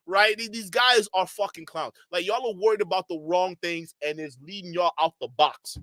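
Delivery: normal talking voice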